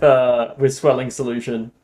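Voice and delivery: normal talking voice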